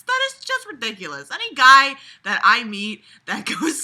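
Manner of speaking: worked-up, disbelieving rant about creepiness; exasperated rant. Real, not acted. Ranting about creepy behaviour.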